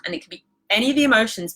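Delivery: ordinary talk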